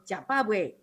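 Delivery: "Jia ba wei." said in Taiwanese tones; Taiwanese greeting. Shown as a demonstration. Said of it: "our Taiwanese dialect, we say".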